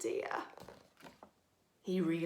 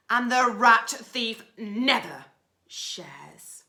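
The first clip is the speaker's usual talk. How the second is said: loud, booming highway rat; booming bandit rat; thundering rat; 10 dB louder than usual. Acted. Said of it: "who thundered".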